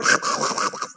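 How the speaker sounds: throaty rasping imitation of Hebrew sounds; comically exaggerated guttural throat-clearing; mock Hebrew throat noises. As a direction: imitate foreign speech with throat sounds only. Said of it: "that's what Hebrew sounds like".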